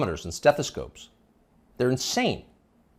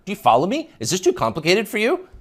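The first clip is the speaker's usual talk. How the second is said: higher, talking down like a know-it-all; condescending upspeak; 7 semitones higher, 4 dB louder than usual. Retold or imitated. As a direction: belittle the listener with rising questions. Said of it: "belittle the listener".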